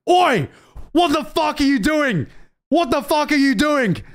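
high-pitched mock yell; retold teacher's shout. Teacher running out at scattering kids, mock shouting. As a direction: call out like a teacher breaking up a fight. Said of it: "starts shouting".

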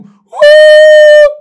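very high, loud held whoop; wild crowd whoop. Retold or imitated. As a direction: scream like a stadium crowd. Crowd cheering wildly.

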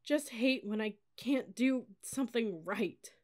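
high, slow, stammering sobs; halting tearful outburst; crying. A narrator's reading. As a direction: protest through the tears. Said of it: "to let me cry".

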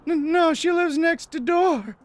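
very high, slow quavery falsetto; nervous woman. Acted. Nervous neighbor answering a stranger at the door, high falsetto. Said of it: "whoever it was sounded nervous".

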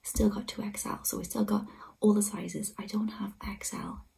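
thrilled breathless whisper. Real, not acted. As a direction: whisper the good news, stay hushed. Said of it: "I'm whispering 'cause".

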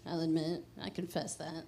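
ordinary talk, nothing marked

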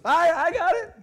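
loud, high-pitched holler; triumphant shout. Acted. Searcher announcing the found contact lens, shouting. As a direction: call it out to the whole gym. Acting out finder of lost lens.

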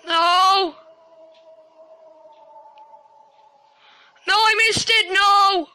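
pleading shout for mercy; begging, panicked and loud; panicking gamer. Real, not acted. Monster attacks, pleading.

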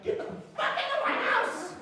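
high, screechy mock yell; chubby angry kid. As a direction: shriek it like a furious little kid. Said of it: "I was chubby and I had long nails".

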